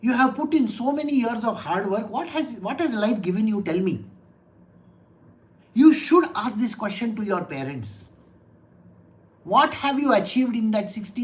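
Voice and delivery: ordinary talk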